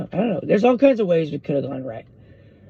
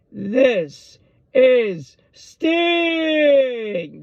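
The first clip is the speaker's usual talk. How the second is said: high, very drawn-out ring announcement; Gary Michael Cappetta; 9 semitones higher, 3 dB louder, much slower than usual. Retold or imitated. Ring announcer introducing Sting to the crowd, high and long-held. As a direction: announce a wrestler, stretching the name into a long falling wail. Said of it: "That was the announcement from Gary Michael Cappetta".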